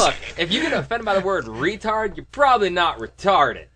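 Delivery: loud, high-pitched retold rant; comic's retort. Comic setting critics straight, loud mock lecturing. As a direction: push the quoted punchline up and out. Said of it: "He's like".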